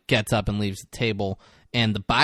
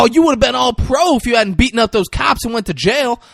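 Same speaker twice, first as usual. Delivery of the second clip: loud, high whooping greeting; hammed-up fan excitement; excited recognition; 11 semitones higher, 6 dB louder, faster than usual. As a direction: holler it like spotting a star. Same excited character continues.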